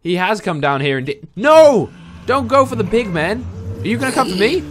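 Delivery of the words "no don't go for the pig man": sudden panicked yell; alarmed shout at the golem. Real, not acted. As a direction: cry out to stop him. Golem about to attack pig.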